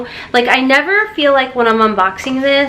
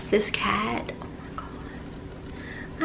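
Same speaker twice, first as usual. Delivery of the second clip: breathless adoring whisper; 3 semitones lower, 16 dB quieter, much slower than usual. Real, not acted. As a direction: whisper it so the cat isn't disturbed. Whispering about the cat.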